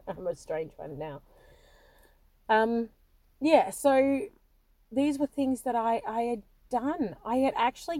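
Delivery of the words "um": normal talking voice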